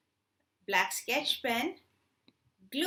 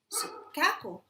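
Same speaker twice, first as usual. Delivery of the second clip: ordinary delivery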